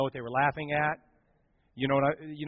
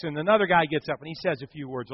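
normal talking voice

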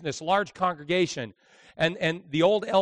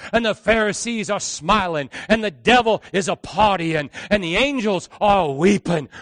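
fiery preaching; 3 semitones higher, 9 dB louder, slower than usual. Retold or imitated. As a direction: preach with rising fire, slowing on Sunday. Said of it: "he caught on fire".

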